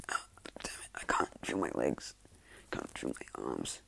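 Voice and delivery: low, very soft, slowing to a crawl; helpless quiet strain, sinking in pitch; weakened groaning. Acted. Paralyzed villain on the ground, low weak complaint.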